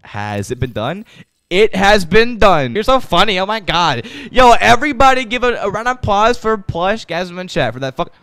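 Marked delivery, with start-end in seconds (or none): 1.5-8.1 loud, high hyped-up announcing; excited acclaim. Real, not acted. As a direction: hail it to the whole crowd. Hailing a funny chat member.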